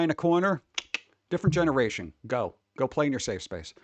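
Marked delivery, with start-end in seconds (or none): none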